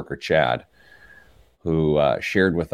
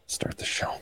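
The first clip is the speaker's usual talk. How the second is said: soft whispered cue; whispered aside; 11 dB quieter than usual. Real, not acted. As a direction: whisper it off mic.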